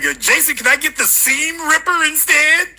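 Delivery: high mock girlfriend voice. Host imitating a girlfriend asking Jason, high and whiny. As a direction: mimic a girl begging for a cheaper gift, high. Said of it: "She's like".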